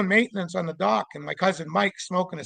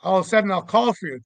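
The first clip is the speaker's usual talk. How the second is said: ordinary talk, nothing marked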